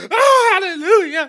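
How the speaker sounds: hammed-up praise shout from the pews; church shout. Imitating church shouting to mock a commenter, high joyful cry.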